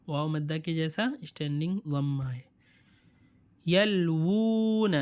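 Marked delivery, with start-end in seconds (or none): 3.7-4.9 یلوون drawn out slowly, higher; slow reading demo. Shown as a demonstration. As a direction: stretch یلوون with a long oo. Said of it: "واو کے اپ side".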